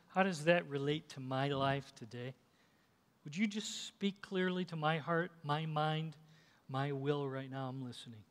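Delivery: soft, earnest prayer to the Lord; hushed prayer. Acted. Leads a closing prayer.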